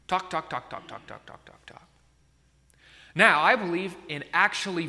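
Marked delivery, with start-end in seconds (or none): 0.1-1.9 fading repetition. Pretend. Mocking endless app chatter.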